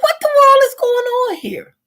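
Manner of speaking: high-pitched, incredulous outburst; exasperated disbelief. Retold or imitated. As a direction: react in high, baffled dismay. Reacts to unsupervised child in retold story.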